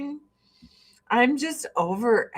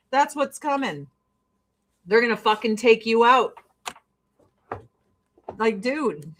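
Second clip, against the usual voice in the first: ordinary talk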